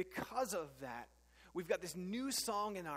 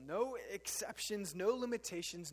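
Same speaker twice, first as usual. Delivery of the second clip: usual speaking voice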